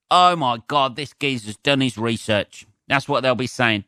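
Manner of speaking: ordinary talk, nothing marked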